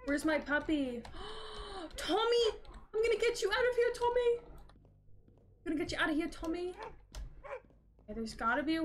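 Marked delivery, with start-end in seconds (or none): 1.1-1.9 soft surprised gasp; sharp gasp. Real, not acted. Player spotting his trapped puppy, sharp gasp. 2.0-6.7 calling out. Real, not acted. Player calling to his trapped dog Tommy, high urgent call.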